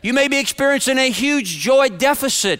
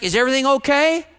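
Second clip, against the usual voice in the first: wife's cheery, higher-pitched question; 4 semitones higher, faster than usual. Retold or imitated. Wife checking on husband by phone, cheery. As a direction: ask it high and cheerily, imitating her. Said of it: "Judy said a cheery".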